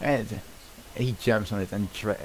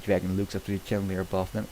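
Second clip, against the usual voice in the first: ordinary delivery, nothing marked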